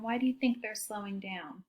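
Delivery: ordinary delivery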